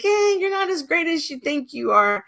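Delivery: high-pitched playful needling; mock teasing. Poking at a narcissist in a story, playfully teasing. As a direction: chirp a light, mock put-down.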